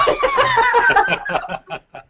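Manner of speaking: high falling laugh. Real, not acted. Laughing at the irony.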